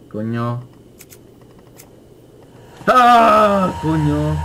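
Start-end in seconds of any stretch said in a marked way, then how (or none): 2.9-3.7 sudden scared yelp; grito de susto. Real, not acted. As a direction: jump at the scare. Susto en juego de terror. (grito corto de susto)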